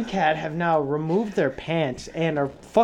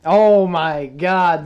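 exasperated; 8 dB louder, much slower than usual. Real, not acted. Exasperated at friend's claim.

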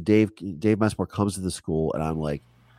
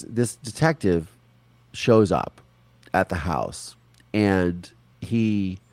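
ordinary delivery

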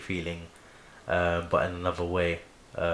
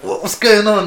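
loud, high-pitched burst of alarm; shocked onlookers; 13 semitones higher, 13 dB louder than usual. Retold or imitated. Fan voicing onlookers as the hero gets stabbed, shocked. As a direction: cry out like a stunned crowd.

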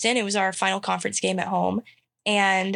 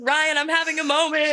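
higher, teary protest; 7 semitones higher than usual. Retold or imitated. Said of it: "I was like".

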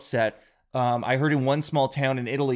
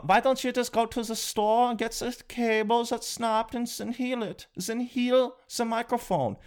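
high, nasal-free mocking fan voice, higher throughout; Swiss fan voice; 8 semitones higher than usual. A Swiss fan giving helpful advice, high and mocking. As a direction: chirp it like a clueless helpful fan. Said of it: "you go".

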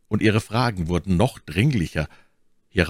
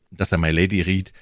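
ordinary delivery, nothing marked

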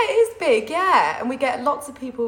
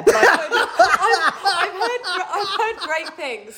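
laughing; 7 semitones higher, 6 dB louder than usual. Real, not acted. Caught out, laughs mid-answer.

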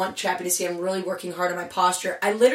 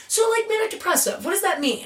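higher, lightly voiced curious friend; imitating Gina; 10 semitones higher than usual. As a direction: ask like an innocent friend. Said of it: "Gina is asking me questions like".

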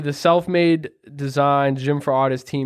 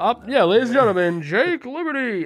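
high, fast ring announcer call; wrestling announcer; 8 semitones higher than usual. Pretend. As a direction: belt out the ring introduction. Announcer intro for wrestling show.

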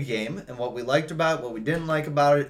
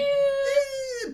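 high mock cry. Mocking an L A Dodger claim.

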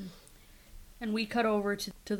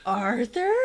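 astonished; 5 semitones higher, 8 dB louder than usual. Retold or imitated. Staff stunned by transformed man.

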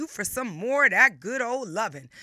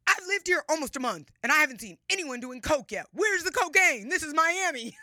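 mock loud tourist, pitched higher; loud, higher imitation of a brash newcomer; imitating a loud guy; 5 semitones higher, 4 dB louder than usual. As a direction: play a guy loudly demanding cocaine. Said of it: "said very loudly".

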